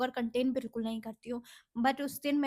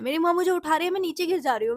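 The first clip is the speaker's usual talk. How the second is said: ordinary talk, nothing marked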